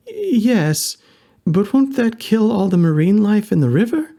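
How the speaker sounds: put-on high, concerned young woman; worried young woman.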